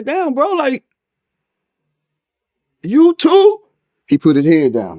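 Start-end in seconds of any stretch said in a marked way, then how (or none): 0.0-3.6 stunned to find his friend locked up too; shocked disbelief. Retold or imitated. To his friend in the next cell, high shocked disbelief. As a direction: voice jumps high, the last words loud and strained.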